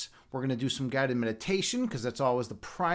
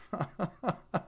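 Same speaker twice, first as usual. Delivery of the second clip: soft run of chuckles; quiet, giggly laughter; 2 semitones higher, 7 dB quieter than usual. Real, not acted. Laughing after asking if audience pleased.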